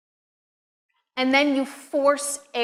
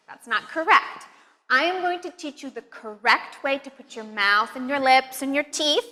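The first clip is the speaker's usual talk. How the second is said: ordinary talk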